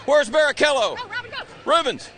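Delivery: loud, high call with a laugh. Real, not acted. Reporter searching the grid for Rubens, loud laughing call. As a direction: shout his name across the grid. Calling out for a driver.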